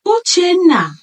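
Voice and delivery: high, loud call of his name. A narrator's reading. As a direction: shout his name through the house. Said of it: "She called again, louder this time".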